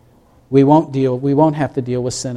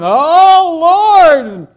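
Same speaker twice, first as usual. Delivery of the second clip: loud, high-pitched wail to heaven; acted panic; 13 semitones higher, 6 dB louder than usual. Believer as the storm comes, acted panicked wail. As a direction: cry out in hammed-up terror, then drop. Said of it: "That's how they felt".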